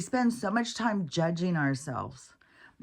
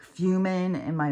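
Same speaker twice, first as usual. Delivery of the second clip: ordinary talk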